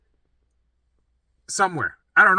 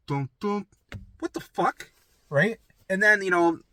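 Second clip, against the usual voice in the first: ordinary delivery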